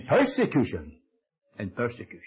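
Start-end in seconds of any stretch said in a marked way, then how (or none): 1.6-2.2 a quiet inward echo of the line; soft echo. Shown as a demonstration. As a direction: repeat it hushed and low, almost to yourself. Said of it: "There's a place by him in persecution".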